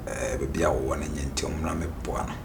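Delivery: slow, unvoiced whisper. Real, not acted. Man retelling the daughter's plea, in a slow whisper. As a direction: hush it right down. Recounting what she told them.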